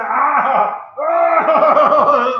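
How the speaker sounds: loud mock shout of frustration; exasperated shout. Husband shouting at his wife in frustration, loud mock yell. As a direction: bark out the frustrated cries. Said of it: "para no decirle a mi esposa".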